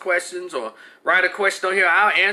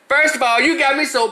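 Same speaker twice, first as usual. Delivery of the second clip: mock indignant outburst; 9 semitones higher, 4 dB louder than usual. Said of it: "We'll do this".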